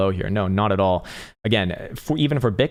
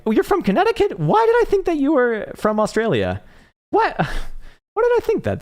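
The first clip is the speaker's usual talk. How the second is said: very high squeaky disbelief; voice cracking upward; 4 dB louder than usual. Real, not acted. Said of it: "My stupid voice is, like, cracking to the upside".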